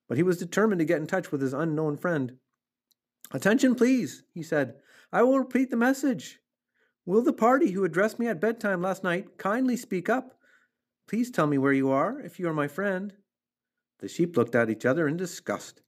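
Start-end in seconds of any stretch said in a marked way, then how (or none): 3.3-4.2 loud, high call for attention; insistent formal announcement; loud, firm voice. A narrator's reading. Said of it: "in a loud, firm voice". 5.1-13.2 high, insistent public appeal; earnest raised-voice proclamation. A narrator's reading. Wilbur repeats his loud announcement.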